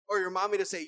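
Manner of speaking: ordinary talk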